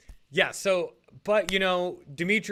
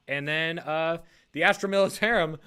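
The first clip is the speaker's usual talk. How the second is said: usual speaking voice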